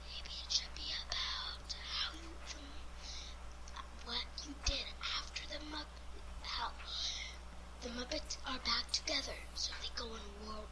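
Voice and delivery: soft, breathy whispered quote. Retold or imitated. Villain whispering his idea to Kermit, hushed. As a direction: hush it as the villain's sly suggestion.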